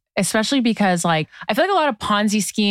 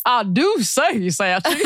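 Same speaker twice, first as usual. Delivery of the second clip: put-on slow, drawn-out Southern accent, pitched up; mock Southern drawl; 5 semitones higher, slower than usual. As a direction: play a hammy Southern gentleman accent. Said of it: "I would go, like, full Southern".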